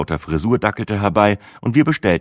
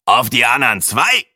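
higher, croaky man's question; croaking; 5 semitones higher, 4 dB louder than usual. A narrator's reading. Man at the table behind butting in, croaking. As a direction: croak it across at the next table. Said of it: "krächzte eine Männerstimme".

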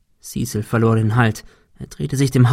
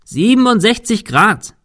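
"Siebenundsechzig" called much higher and louder with a rising end; shouting; 10 semitones higher, 4 dB louder than usual. A narrator's reading. Thomas calling the angle to Cecil through rain, shouting. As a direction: shout the angle across the roof. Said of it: "rief Thomas dem Wind entgegen".

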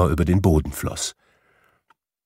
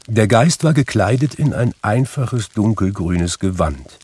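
ordinary delivery, nothing marked

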